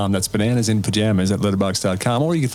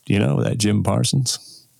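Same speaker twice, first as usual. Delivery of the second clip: slow, drawn-out dad voice; a son imitating his dad's slow verdict on an actor; dad impression; slower than usual. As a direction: do your dad, slow and deliberate, pleased with the actor. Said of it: "and he would go".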